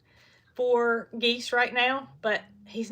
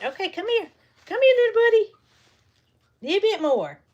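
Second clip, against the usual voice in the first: coaxing call; 9 semitones higher, 6 dB louder than usual. Real, not acted. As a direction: coax him in with a sweet high call. Calling the goose.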